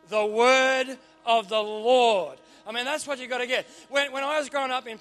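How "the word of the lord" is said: put-on slow, drawn-out and louder old Pentecostal accent. Shown as a demonstration. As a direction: stretch every word like an old Pentecostal preacher. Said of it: "say it with some sort of weird accent".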